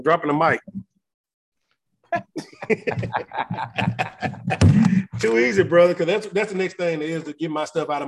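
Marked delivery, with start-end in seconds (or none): 2.1-5.1 delighted laugh. Real, not acted. Laughs at the mic drop. 5.2-5.9 excited. Real, not acted. Hyped after the mic drop.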